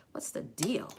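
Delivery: whispered aside; whisper to herself. Real, not acted. Makeup artist scolding herself, a whispered aside. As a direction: breathe it under the breath. Muttering about heavy hand.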